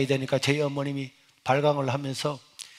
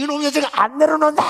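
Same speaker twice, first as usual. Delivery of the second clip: high, louder furious demand; 11 semitones higher, 7 dB louder, faster than usual. Retold or imitated. Mother struggling on her son's back, high furious demand. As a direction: demand he put you down.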